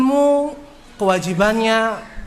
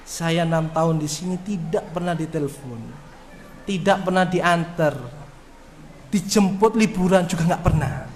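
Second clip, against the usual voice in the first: student's sad reply; 6 semitones lower, 5 dB quieter than usual. Retold or imitated. Lonely student answers ustadz.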